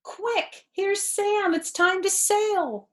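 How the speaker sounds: bright, urgent announcement; urgent high reading. A narrator's reading. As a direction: sound hurried and high-pitched. Urgent story moment, high voice.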